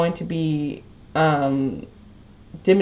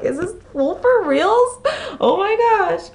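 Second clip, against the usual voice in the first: much higher, squealing disbelief; shocked disbelief; 14 semitones higher than usual. Retold or imitated. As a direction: shriek at how absurd he is. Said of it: "And I'm like".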